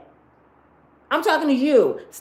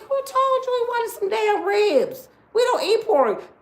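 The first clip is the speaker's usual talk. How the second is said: higher, slower indignant reply; mock offended Muslims; 9 semitones higher than usual. Muslims snapping back at her ribs offer, indignant. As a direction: play them baffled at the ribs.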